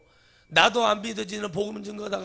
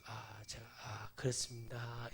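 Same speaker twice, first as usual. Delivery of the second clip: much softer, slow and humble; meek soft reply; 7 semitones lower, 14 dB quieter, slower than usual. Pretend. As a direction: answer shyly, barely above a breath. Meek imagined country reply.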